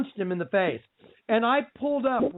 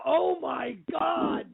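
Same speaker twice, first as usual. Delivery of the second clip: slow, high, drawn-out oh my God; stunned oh my God; 7 semitones higher, much slower than usual. Retold or imitated. Asking his cohost if she reacted: slow awed oh my God. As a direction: stretch it out in awe. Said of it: "did you just go".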